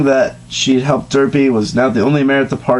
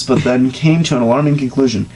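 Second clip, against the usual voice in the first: ordinary delivery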